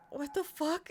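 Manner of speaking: high, stunned what the fuck; stunned disbelief. Retold or imitated. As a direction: blurt it out in shock, voice jumping up. Retold shocked reaction.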